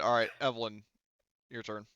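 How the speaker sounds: ordinary talk, nothing marked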